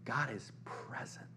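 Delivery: slow reverent whisper; hushed whisper. Real, not acted. Preacher telling the church God is here, hushed whisper. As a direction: let it drop to a slow whisper. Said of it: "preacher whispers truth".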